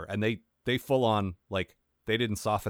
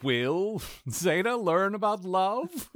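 announcer voice; 10 semitones higher, much slower than usual. Pretend. Mock trailer announcer.

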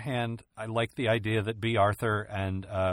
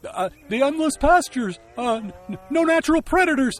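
much higher, stammering cow voice; flustered cow; 13 semitones higher, 9 dB louder than usual. Acted. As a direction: scramble for an answer, panicking. Cow stalls naming things.

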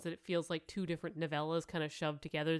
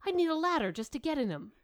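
put-on much higher, quick, scandalised old witch; indignant quip; 10 semitones higher, 4 dB louder, faster than usual. Nanny mocks the young woman's shoes, scandalised. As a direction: fire it off, scandalised.

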